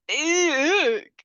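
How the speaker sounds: high, loud ick of disgust. Real, not acted. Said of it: "disgust at the scene".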